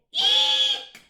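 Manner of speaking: piercing high shriek of a barn owl; owl screech. Acted. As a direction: screech to show how it's done. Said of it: "barn owls say".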